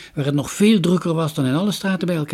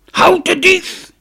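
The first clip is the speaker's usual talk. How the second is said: much higher, loud, slow furious cry; shout; 10 dB louder, slower than usual. A narrator's reading. Merchant yells after the boy, furious shout. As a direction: yell after the thief. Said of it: "schreeuwde de koopman".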